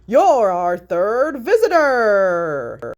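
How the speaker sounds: loud, slow, drawn-out announcer boom; jolly booming announcer. A narrator's reading. Ceiling announcer congratulating Haley, booming and slow. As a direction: stretch it out like a game-show host. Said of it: "boomed the jolly announcer".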